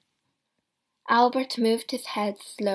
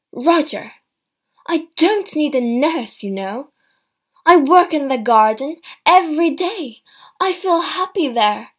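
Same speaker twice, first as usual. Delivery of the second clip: indignant; 5 semitones higher, 10 dB louder than usual. A narrator's reading. Mother rebuffs son's advice.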